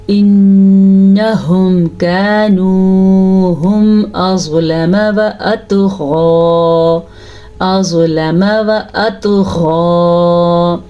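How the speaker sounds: very slow chant with ghunna and heavy ظ; Quran recitation. Shown as a demonstration. Teacher reciting the verse for her class, slow chant. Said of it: "نون کا غنہ ہم نے ادا کیا".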